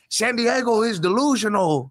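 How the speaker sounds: high-pitched, drawn-out mock yell; mock screaming. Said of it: "You were right there screaming like".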